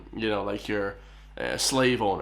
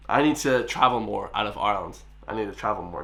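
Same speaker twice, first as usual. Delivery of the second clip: ordinary talk, nothing marked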